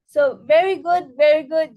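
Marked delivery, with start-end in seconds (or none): none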